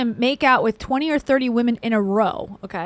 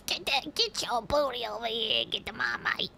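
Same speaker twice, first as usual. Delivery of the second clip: softer mock Australian accent; 8 dB quieter than usual. Shown as a demonstration. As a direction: order it quietly in a put-on Australian accent. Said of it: "That's my Australian accent".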